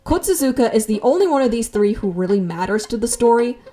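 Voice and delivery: ordinary talk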